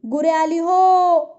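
long, high drawn-out call across the yard; calling out loud. Acted. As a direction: call the cattle home loudly and slowly. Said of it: "एवढे ओरडून".

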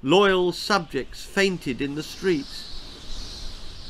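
ordinary delivery, nothing marked

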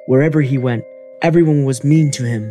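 usual speaking voice

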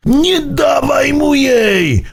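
high, slow creature cry; high urgent shout. A narrator's reading. Niesforek warns Burbi from behind, a high shout. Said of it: "usłyszał nagle głos niesforka".

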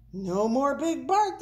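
high storybook cheer, rising. A narrator's reading. Crowd sees Big Bark carried off, cheering. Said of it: "the crowd cheered".

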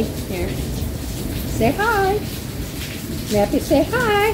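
high sing-song voice for the dog; coaxing the dog to say hi, high pet voice; pet talk. Real, not acted. Coaxing the dog to greet viewers.

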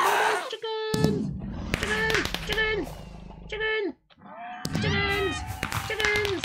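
high squeaky chicken calls. Pretend. Gamer playing a chicken, calling in a high chicken voice. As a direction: squawk it as a silly chicken.

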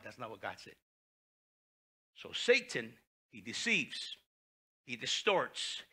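ordinary delivery

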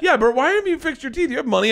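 high-pitched imitation of a pestering partner; mock challenge. Partner asks about unfixed teeth, high mock challenge. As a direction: press the question in a high, put-on voice.

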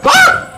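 short, loud, high-pitched yelp; real jump-scare scream; startled scream. Real, not acted. Player caught off guard by the game, startled scream.

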